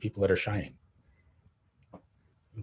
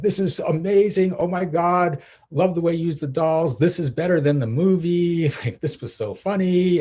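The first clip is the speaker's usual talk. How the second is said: higher and louder, mock gushing fan; 6 semitones higher, 6 dB louder than usual. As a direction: play the thrilled commenter raving about the video. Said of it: "It was like".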